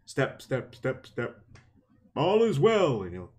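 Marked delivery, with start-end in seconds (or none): 0.0-1.4 evenly spaced, chopped words like footsteps; mimicked marching count. Imitating regimented guards on patrol, marching rhythm. 2.2-3.0 high, lilting town-crier cry; mock sentry call. Imitating regimented guards on their routine, mock sentry call.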